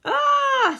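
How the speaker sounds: loud, high "aah"; story-voiced wail of dismay; alarmed cry. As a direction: cry out high at bad news. Rabbit hears the bad news.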